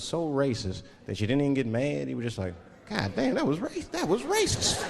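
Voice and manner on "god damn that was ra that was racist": stunned disbelief. Retold or imitated. Stunned reaction to racism.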